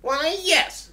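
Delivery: very high, loud, showy reply; boastful dragon. Acted. Dragon answers Elizabeth's challenge, loudly boastful. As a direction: crow it proudly, way up high.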